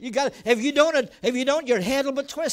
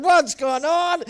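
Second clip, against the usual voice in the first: higher, louder, slow alarmed call; retold alarmed call; 4 semitones higher, 7 dB louder, slower than usual. Pastor retelling a call from the car, alarmed. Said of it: "and I said".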